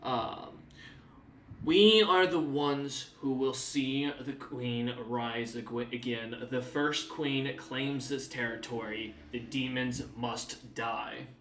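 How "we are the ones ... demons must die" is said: slow, ominous chant-like voice; speaking in primitive. Acted. Woman repeating the hag's words in primitive, slow and ominous. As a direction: intone it slowly like a dark creed. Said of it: "begins to speak in primitive".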